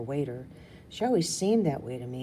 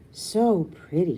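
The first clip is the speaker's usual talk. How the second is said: slow, dreamy and a bit louder; gushing; 3 semitones higher, 7 dB louder, slower than usual. Acted. Little sister swooning over the palace balcony, gushing.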